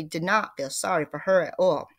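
usual speaking voice

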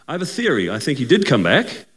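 ordinary talk, nothing marked

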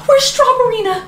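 high, whiny panic; frantic demand. Acted. Mother needing her comfort toy, frantic high demand. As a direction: demand it like a desperate child.

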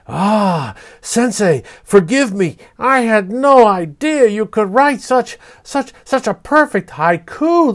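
high, loud awed fox voice. A narrator's reading. Fox begging the poet to recite again, high and awed.

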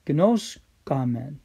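"Gnoskamen" drawn out slowly; teacher modelling "Gnoskamen" slowly; slow pronunciation. Shown as a demonstration. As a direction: spell out "Gnoskamen" slowly for the class. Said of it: "We know".

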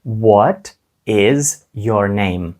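very slow, careful "What is your name?"; slow demo. Shown as a demonstration. Teacher models the question slowly for children. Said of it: "я скажу".

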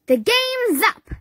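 high, loud heroic yell; the hero calling out the villain. A narrator's reading. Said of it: "yelled Sweet Potato".

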